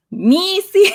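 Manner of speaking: Missy said with mock Spanish vowels; Spanish-accented Missy, high. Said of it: "getting her Spanish accent".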